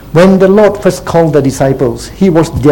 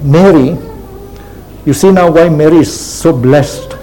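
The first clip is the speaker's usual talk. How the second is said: ordinary delivery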